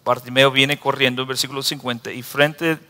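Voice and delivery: ordinary talk, nothing marked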